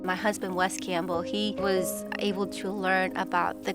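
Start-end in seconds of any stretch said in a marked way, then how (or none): none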